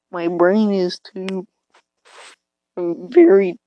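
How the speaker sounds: usual speaking voice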